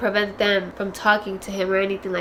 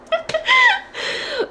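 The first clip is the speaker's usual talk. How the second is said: high teary laugh-sob; laugh breaking into a cry. Real, not acted. Laughing through tears to her viewers about a sad book. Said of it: "I wanna cry".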